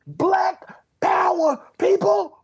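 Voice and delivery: very high, loud joyful cry; excited cheer. Real, not acted. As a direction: shout it out in celebration.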